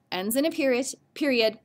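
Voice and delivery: ordinary delivery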